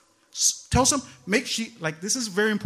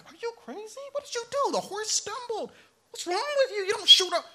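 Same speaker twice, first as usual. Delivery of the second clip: much higher, alarmed outcry at the wife; horrified disbelief, pitched way up; shocked husband; 12 semitones higher than usual. Retold or imitated. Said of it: "And I was like".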